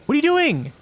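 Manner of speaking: near the end of your rope with the game; exasperated outburst. Real, not acted. Game misbehaves again.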